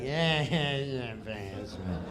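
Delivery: low, soft laughing brush-off; Norm's mumble. Retold or imitated. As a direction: shrug it off quietly. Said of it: "looking at us like".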